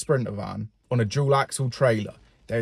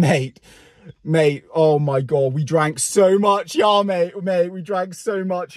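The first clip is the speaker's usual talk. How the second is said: louder, slow, drawn-out bragging; mock boastful colleague; 5 semitones higher, 4 dB louder, slower than usual. Colleague boasting of the weekend's drinking, loud hammed-up drawl. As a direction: send up a lad milking his story.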